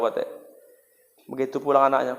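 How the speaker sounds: usual speaking voice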